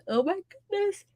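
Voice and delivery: high, soft words pulled out by a real yawn. Real, not acted. Streamer caught yawning while reading chat, high and soft. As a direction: let the yawn take over the words. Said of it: "Yawns are contagious".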